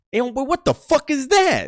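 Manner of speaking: high mock shout of shock; shocked high yell at the showers; homie yelling. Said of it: "one of the homies goes".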